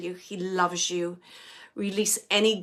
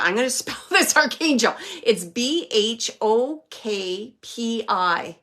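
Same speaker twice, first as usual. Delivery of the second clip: normal talking voice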